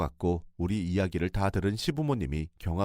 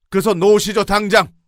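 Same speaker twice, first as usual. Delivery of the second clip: much higher and louder command; house-shaking shout; 11 semitones higher, 12 dB louder than usual. Retold or imitated. Father-in-law roars at the in-law to release her. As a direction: roar to make him let go. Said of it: "집안이 떠내려가도록 소리를 질렀어요".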